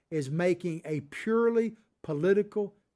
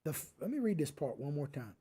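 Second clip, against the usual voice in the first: normal talking voice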